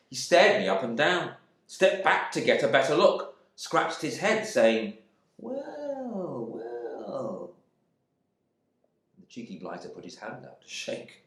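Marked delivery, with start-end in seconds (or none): 5.4-7.5 drawn-out, falling well, well; amazed stranger. Acted. Gilbert sizing up the returned husband, amazed. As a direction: take him in slowly.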